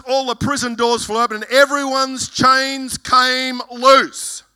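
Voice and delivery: ordinary talk, nothing marked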